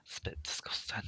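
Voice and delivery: hushed whisper of disgust. Real, not acted. Friend whispering agreement that it's gross. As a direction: whisper it like a guilty secret. Quiet aside after "that's mank.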